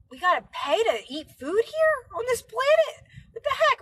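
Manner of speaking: high incredulous squeak; mock disbelief. Real, not acted. Telling viewers she can't believe we pay, high incredulity. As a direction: act stunned and outraged, pitch up.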